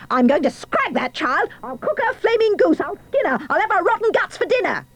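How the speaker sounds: queen's high-pitched raging tirade; fly into a high, shrieking fury, building as you go; enraged yell. A narrator's reading. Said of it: "She yelled".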